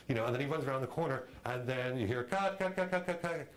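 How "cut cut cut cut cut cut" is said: high repeated calls of cut; mock director shouting cut, pitched high; director calling cut. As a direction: stop the take from behind the camera.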